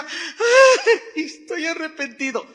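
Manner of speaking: lamento burlón, agudo y exagerado; llanto fingido. Retold or imitated. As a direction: finge llorar medio riéndose. (imitando al descubierto que finge llorar)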